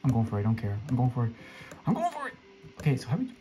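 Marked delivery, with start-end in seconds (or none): 1.9-2.3 sudden squeaky high voice; very high, excited little cry; high-pitched squeal. Real, not acted. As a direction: squeal it out as you take the plunge. Repeats resolve in sudden high voice.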